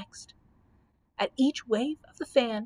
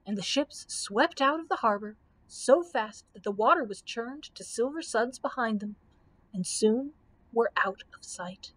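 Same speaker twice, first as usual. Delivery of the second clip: ordinary delivery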